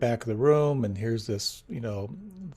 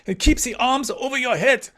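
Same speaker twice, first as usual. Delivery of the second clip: much higher, louder teacher's order; commanding teacher; 11 semitones higher, 5 dB louder than usual. Retold or imitated. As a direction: bark out the instruction like a strict instructor. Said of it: "he goes".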